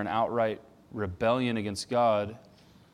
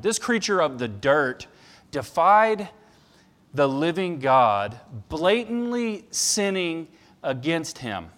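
louder, rising to a high peak on defied; building preached quote; 5 semitones higher, 7 dB louder, slower than usual. Retold or imitated. Pastor voicing Sproul's indictment of mankind, loud and slow. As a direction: build the charge slowly to an outcry. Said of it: "He says".

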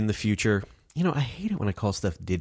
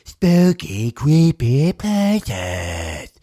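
loud, high, drawn-out spooky announcement; big slow haunted-house title call; 5 semitones higher, 7 dB louder, much slower than usual. Acted. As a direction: stretch every word out in a hammed-up eerie voice. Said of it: "I know it better as".

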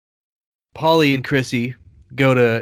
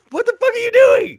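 much higher, louder mock yell; yelled repeat; 7 dB louder than usual. Tony shouting into the phone at Paulie, mock yell. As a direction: shout it again as if the line keeps cutting out. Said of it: "he's like".

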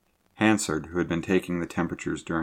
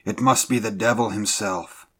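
raised, horrified exclamation; higher-pitched alarm; exclaiming; 4 semitones higher, 4 dB louder than usual. A narrator's reading. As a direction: exclaim it in shock. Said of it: "he exclaimed".

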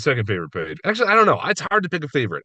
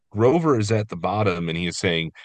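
usual speaking voice

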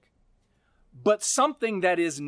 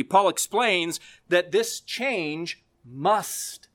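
usual speaking voice

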